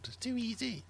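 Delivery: high, whining quiz players; mock complaints, pitched high; whiny complainer. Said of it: "the amount of complaining".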